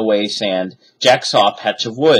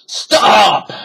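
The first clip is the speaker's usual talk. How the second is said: shout; 11 semitones higher, 6 dB louder than usual. A narrator's reading. Captain yells after fleeing crew.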